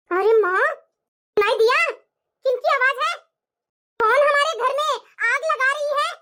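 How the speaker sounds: loud accusing questions; accusing shout. Acted. Daughter-in-law confronting mother-in-law with the recording, loud accusing. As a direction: hammer home the proof.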